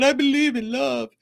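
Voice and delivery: high, half-hearted teary gush; imitating a weepy romcom fan; mock sob over a romantic comedy ending. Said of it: "I'm crying at the end going".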